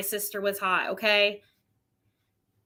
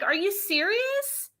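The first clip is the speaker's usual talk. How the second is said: incredulous; 10 semitones higher, 3 dB quieter than usual. Retold or imitated. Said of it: "I would laugh because I'm like".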